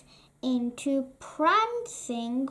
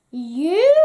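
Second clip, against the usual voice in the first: loud, mocking cackled "You?"; cackling scorn; 3 semitones higher, 9 dB louder than usual. A narrator's reading. Stepmother mocking Cinderella's hope, loud scornful cackle. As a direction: sneer it down at her. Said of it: "cackled her stepmother".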